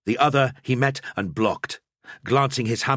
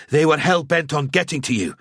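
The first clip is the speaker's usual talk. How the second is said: higher, louder angry snarl; 7 semitones higher, 4 dB louder than usual. A narrator's reading. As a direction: snarl it at her. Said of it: "Gruber snarled".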